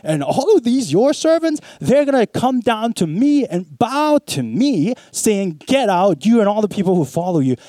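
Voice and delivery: high, emphatic declaration; bold, courageous. Acted. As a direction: speak up boldly, pitched high. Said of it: "pretty bold, pretty courageous".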